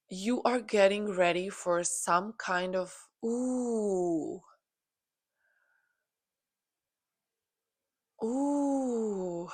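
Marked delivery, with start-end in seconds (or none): 3.2-9.5 long falling "ooh", slightly raised; real sustained ooh of sudden sensation; drawn-out ooh. Real, not acted. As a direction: let out a long, airy ooh. Struck by sudden intuition mid-reading.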